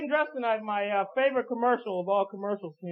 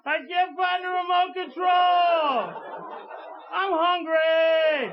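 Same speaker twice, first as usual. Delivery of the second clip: whining shout; 7 semitones higher, 3 dB louder, much slower than usual. Pretend. Lazy man using the device.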